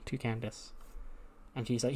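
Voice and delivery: ordinary talk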